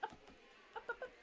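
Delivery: high, quick pet commands; high-pitched repeated dog command; coaxing the dog. Real, not acted. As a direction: urge a dog up with short high syllables.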